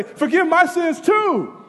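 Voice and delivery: high, slow shouted cry for mercy; shouted plea. Acted. Preacher voicing the plea nobody made, shouted. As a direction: cry it out, slowly.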